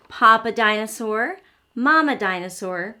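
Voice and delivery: ordinary talk, nothing marked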